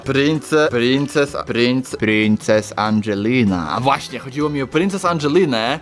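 usual speaking voice

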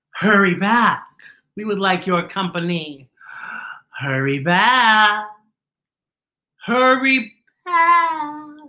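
slow, drawn-out beckoning; slow eerie beckoning voice; ghostly invitation. Acted. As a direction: call them back like a ghost host. Spooky invitation read.

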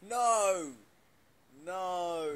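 sudden loud shout of no; loud denial. Real, not acted. Correcting a misunderstanding with chat, loud shouted no. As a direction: yell it to shut the idea down. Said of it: "I don't mean swearing makes people happy".

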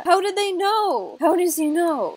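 high, loud wailing question; dramatic dismay at being found out; mock panicked disbelief.

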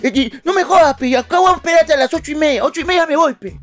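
high-pitched, insistent mock plea; pleading friend. Ayrton begs Caquita and refuses to leave, high insistent voice. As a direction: imitate a stubborn friend begging, way up high.

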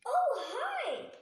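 high, loud cheery greeting; a fairy greeting Lucy, high and loud; fairy's bright exclaim. Acted. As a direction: greet her like a delighted fairy. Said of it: "exclaimed the fairy".

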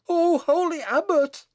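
very high, loud desperate cry; shriek. Acted. Bess begs the abbot for her infant, shrieking. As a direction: shriek it out in pleading alarm. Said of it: "shrieked Bess".

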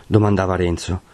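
ordinary delivery

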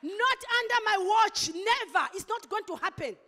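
loud determined declaration of never, building as it goes; retold firm vow. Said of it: "I said".

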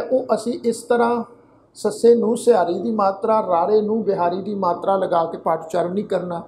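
ordinary talk, nothing marked

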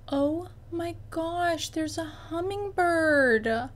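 high, slow, drawn-out amazement; delighted surprise. Real, not acted. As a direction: stretch it out in wonder at a sudden sight. Spots a hummingbird outside window.

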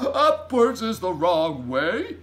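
loud, high, drawn out with laughter; laugh, then cry out in disbelief; big hooting giant protest; giant's scoffing cry. A narrator's reading. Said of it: "cried the B F G".

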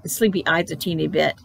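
ordinary delivery

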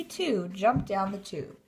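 ordinary delivery

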